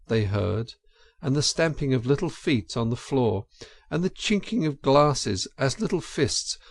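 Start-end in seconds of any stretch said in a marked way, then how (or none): none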